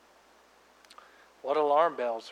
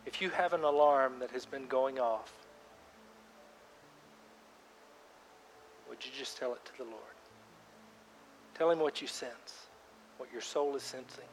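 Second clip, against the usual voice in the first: guided prayer; 10 dB quieter than usual. Acted. Pastor guiding congregation in silent prayer, softly. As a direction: lead them gently into quiet prayer. Leads a quiet prayer time.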